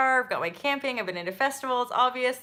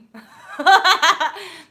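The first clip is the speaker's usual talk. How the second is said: loud laugh; 8 semitones higher, 11 dB louder than usual. Real, not acted. Laughing at police story.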